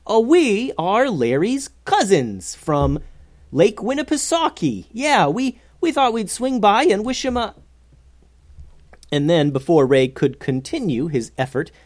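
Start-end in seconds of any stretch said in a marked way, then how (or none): 0.1-7.5 high-pitched improvised bluff; stalling cover story, higher and hesitant. A narrator's reading. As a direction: talk your way out, voice pitched up. Talking their way out of this mess.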